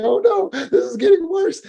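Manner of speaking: squeaky high, laughing fake wail; laughing mock panic. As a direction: ham up the fear while cracking up. Voicing panicked person, laughing.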